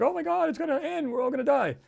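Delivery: high-pitched mimicked doomsday panic; mock panic. Mimicking most people fearing the world's end, mock panicked. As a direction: wail it like a hammed-up alarmist.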